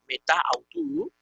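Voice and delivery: "meta autu" as two separate words, the vowels clashing; unelided malsonante form. Shown as a demonstration. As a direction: keep the final a of "meta" apart from "autu". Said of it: "el sonido que resulta malsonante".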